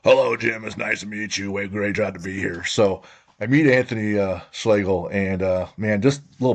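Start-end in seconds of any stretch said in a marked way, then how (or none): 0.0-2.6 imitated raspy voice. Host imitating his guest's greeting at first meeting, mock raspy voice. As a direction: imitate a raspy coach saying hello. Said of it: "he has this raspy voice and is like".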